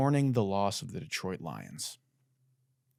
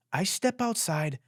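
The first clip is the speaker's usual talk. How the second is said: higher, slow, "step" leaned on; grand mock-swagger announcement; slow swaggering boast; 7 semitones higher, slower than usual. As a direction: strut the words out. Climax of the entrance boast.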